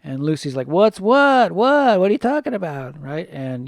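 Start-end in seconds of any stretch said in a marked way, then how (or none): 0.7-3.0 high, loud mock-bewildered "who, me?"; feigned innocence. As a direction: imitate a culprit playing dumb, pitched way up. Acting out Lucy feigning innocence about poop.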